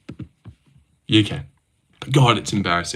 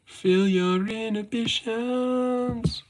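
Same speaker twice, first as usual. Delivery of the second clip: very high, soft and slow, a drawn-out tease; high, slow, hushed mock-seductive delivery; mock seductive line; 12 semitones higher, 8 dB quieter, much slower than usual. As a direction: breathe it out like a sultry come-on. Joking sultry aside.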